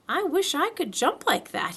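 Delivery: a hint of wistful Pooh; Pooh's voice. A narrator's reading. Pooh voiced thinking aloud.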